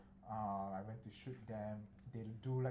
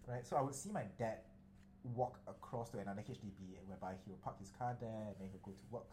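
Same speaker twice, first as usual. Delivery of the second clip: ordinary talk, nothing marked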